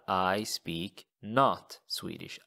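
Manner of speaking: I speak not Swedish, slowly; word-by-word literal translation; slow word-for-word gloss. Shown as a demonstration. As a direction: spell out the literal order. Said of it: "So jag talar".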